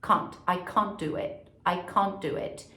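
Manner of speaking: British can't, mouth barely open; British accent. Shown as a demonstration. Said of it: "Você abre muito pouco a boca".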